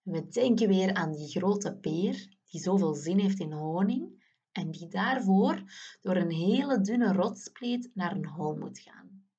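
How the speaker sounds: ordinary talk, nothing marked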